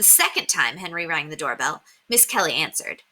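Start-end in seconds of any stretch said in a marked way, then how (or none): none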